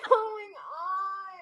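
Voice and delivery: high, drawn-out mock wail; exasperated. Customer reliving her botched manicure, exasperated wail. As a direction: stretch it out in whiny disbelief. Retells exasperation at chaotic salon.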